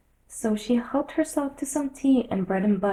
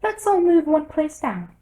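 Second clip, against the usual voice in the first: loud Hatter; 8 semitones higher, 6 dB louder than usual. A narrator's reading. Hatter voiced.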